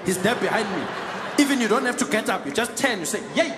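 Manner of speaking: lively South African passenger, pleased with himself; easygoing local uncle talking down a stranger; hint of a South African accent.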